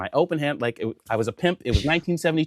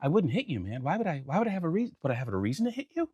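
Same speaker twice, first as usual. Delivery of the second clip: soft, quick feigned innocence; straight-faced denial; 6 semitones higher, 7 dB quieter, faster than usual. Retold or imitated. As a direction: deny it quietly and fast, all innocence. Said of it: "I'm keeping a straight face".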